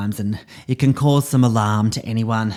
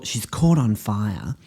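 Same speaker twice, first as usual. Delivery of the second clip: ordinary talk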